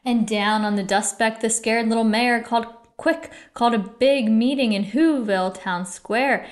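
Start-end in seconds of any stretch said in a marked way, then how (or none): none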